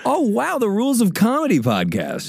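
high, wowed mock-fan voice; hammed-up wonder, swooping high; mock amazement. Voicing crew overhearing the podcast.

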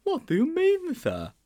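a puzzled schoolboy asking his teacher; high, wide-open boy's question; Sponge's voice. A narrator's reading.

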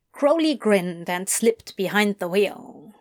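ordinary delivery

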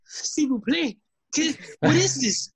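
high, panicky mock French; mock French man. Pierre begging Renee at gunpoint in high mock French. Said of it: "and Pierre goes".

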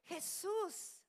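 high, loud cry for Jesus; shouted plea. Retold or imitated. Lepers crying out to Jesus, loud shout. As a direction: call out to Jesus from afar, begging. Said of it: "empezaron a gritar".

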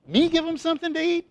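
high, incredulous squeak of "Me"; shocked disciple, pitch jumping up on "Me"; shocked disbelief. Retold or imitated. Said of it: "shocked when he said".

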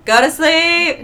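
high, very loud stretched holler; intuition shouting. Acted. Her intuition ordering rest, comic shout. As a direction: yell it as a comic inner command. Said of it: "my intuition will be like".

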